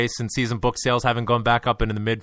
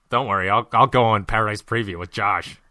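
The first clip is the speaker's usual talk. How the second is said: ordinary talk, nothing marked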